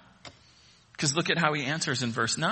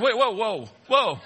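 mock alarm; 6 semitones higher, 4 dB louder than usual. Panicked listener in golf analogy.

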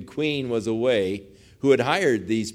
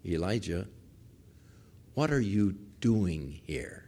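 still small voice; 7 semitones lower, 10 dB quieter than usual. Retold or imitated. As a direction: ask it gently, hushed and unhurried. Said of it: "a still small voice".

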